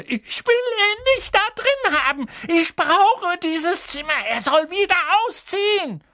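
put-on high, loud spoiled-child yell; bratty boy yelling. A narrator's reading. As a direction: shriek like a spoiled brat. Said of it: "wie er seine Mutter anbrüllte".

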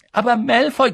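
high, loud objection; protesting pupil. A narrator's reading. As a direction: butt in to protest. (hoher, lauter Einwand)

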